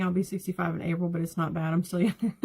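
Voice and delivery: ordinary delivery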